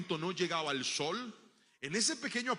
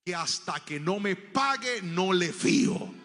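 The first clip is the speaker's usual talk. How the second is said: slow, rising to louder shopkeeper voice; cold slow refusal; stern refusal; 7 dB louder, slower than usual. Retold or imitated. Don Damián to the boy refusing credit, slow and louder. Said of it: "me va diciendo el Señor las siguientes palabras".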